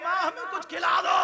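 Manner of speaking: imitated hungry child crying out; child's desperate plea. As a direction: voice climbing high on a pleading cry. Hungry children begging their mother, acted.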